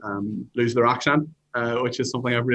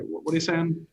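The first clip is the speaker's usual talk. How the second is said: puzzled question; 7 semitones higher than usual. Retold or imitated. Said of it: "some say".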